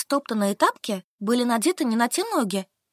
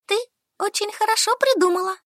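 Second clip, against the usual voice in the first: put-on higher, delighted young woman; Solka pleased; 11 semitones higher than usual. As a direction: praise her, relieved. (выше, радостно)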